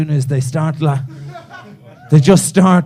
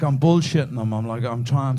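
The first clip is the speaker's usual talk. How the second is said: ordinary talk, nothing marked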